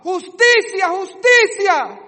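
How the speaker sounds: very high, very loud shout, the second drawn out slowly; Abel's blood screaming to God for justice; shouted cry. Acted. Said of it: "La sangre de Abel gritaba".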